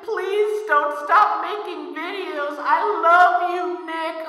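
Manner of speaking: high, slow, singsong mock fawning; gushing fan impression. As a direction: coo like an adoring fan, drawn out and high-pitched. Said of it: "get all the positive comments saying".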